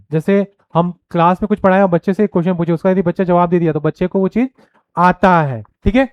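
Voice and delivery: ordinary talk, nothing marked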